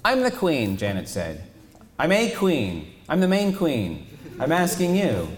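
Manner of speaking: high, loud affronted insistence; affronted royal insistence; indignant queen. Acted. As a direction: insist on your rank. Janet asserts rank, high loud.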